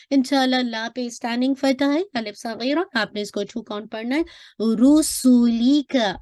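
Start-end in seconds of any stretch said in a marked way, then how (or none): 4.6-6.2 रुसूली का slowly, each letter given one count; slow recitation demo. Shown as a demonstration. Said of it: "हर एक को एक एक count पढ़ना है".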